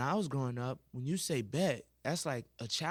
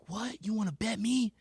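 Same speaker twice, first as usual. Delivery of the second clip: angry teacher; 8 semitones higher than usual. Retold or imitated. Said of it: "She was like".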